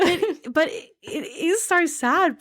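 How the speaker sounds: high, slow voice choked with real tears; tearful. Real, not acted. Host explaining how sad the book is, tearful. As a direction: push the words out while crying. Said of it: "I'm crying again".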